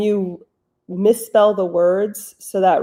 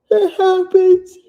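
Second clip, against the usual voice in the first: high cutesy voice; 12 semitones higher, 9 dB louder, slower than usual. Acted.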